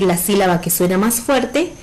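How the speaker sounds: ordinary talk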